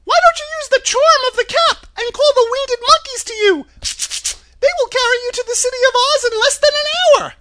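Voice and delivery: very high, loud squeaky little voice; tiny squeaky royal mouse, quick and piercingly high; Queen of the Mice. A narrator's reading. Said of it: "in her squeaky little voice".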